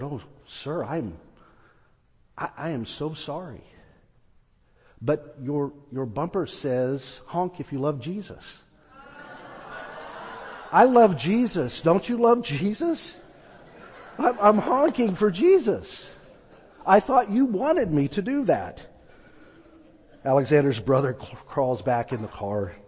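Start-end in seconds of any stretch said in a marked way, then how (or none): 0.0-8.6 meek apology. Retold or imitated. Startled young man apologising. 10.7-18.8 bright, higher, eager voice with a laugh; innocently beaming at the misunderstanding; cheerful young man. Retold or imitated. Young man cheerfully explains honking.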